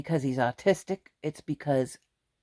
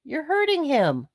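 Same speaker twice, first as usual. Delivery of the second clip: pleading protest; 10 semitones higher than usual. Retold or imitated. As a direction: raise the voice high to stop dad hurting the boy. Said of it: "I said".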